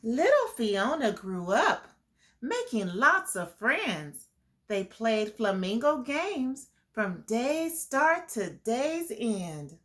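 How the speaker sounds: put-on reading a picture book to kids; lilting storybook read; story narration. A narrator's reading. Teacher reading the flamingo story to the class, lilting. Reads rhyming story aloud.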